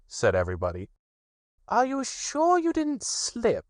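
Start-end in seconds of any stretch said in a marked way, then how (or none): 1.7-3.7 high, slow knowing rabbit voice; high slow sly question; wise Rabbit. Acted. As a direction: Rabbit playing the wise one. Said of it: "asked Rabbit wisely".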